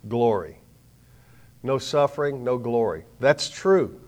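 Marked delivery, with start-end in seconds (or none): none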